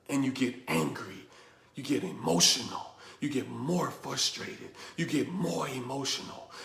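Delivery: low, hushed and pointed; hushed, grave point. Real, not acted. Speaker bringing the snake lesson home to listeners, hushed. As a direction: drop low and soft to drive the point home.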